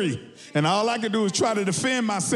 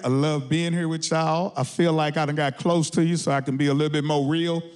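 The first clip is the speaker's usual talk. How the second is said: usual speaking voice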